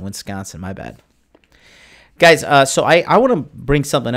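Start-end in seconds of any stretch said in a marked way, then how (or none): 0.0-1.0 much softer, lower apology; sheepish low aside after the slip; sheepish mumble. Real, not acted. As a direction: mutter the apology under your breath. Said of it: "Sorry, Wisconsin".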